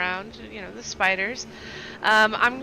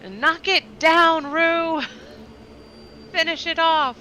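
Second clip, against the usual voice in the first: battle shout; 8 semitones higher, 8 dB louder than usual. Acted. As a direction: shout orders to an ally across the fight. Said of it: "then she just shouts".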